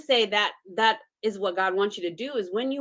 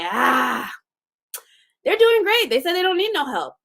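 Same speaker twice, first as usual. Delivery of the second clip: much higher, chatty, casual voice; breezy gossip; 7 semitones higher, 3 dB louder than usual. Retold or imitated. A friend casually reporting that someone refused help, high and breezy. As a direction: toss it off lightly, like idle gossip.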